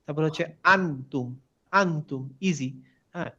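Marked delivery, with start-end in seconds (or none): none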